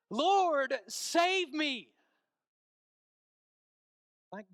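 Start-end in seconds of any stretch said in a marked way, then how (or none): 0.1-1.9 high, very loud desperate cry; calling out. Retold or imitated. Sinking Peter calls to the Lord, desperate shout. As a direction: cry out for rescue at full voice. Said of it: "And he calls out".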